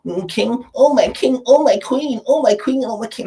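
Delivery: hammed-up high mock affection; mocking fake king and queen talk. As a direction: croon oh my king like a try-hard imitator. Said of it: "That's not how you talk".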